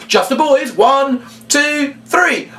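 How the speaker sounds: put-on rally the kids; excited count-in. Shown as a demonstration. Entertainer counting the boys in, excited.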